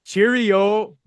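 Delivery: a New Jersey stab at British "Cheerio"; attempted British. Shown as a demonstration. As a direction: give British "Cheerio" a shy try. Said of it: "could you say it in a very British way".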